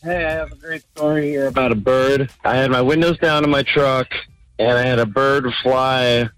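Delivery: ordinary talk, nothing marked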